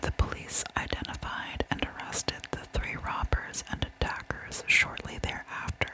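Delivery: usual speaking voice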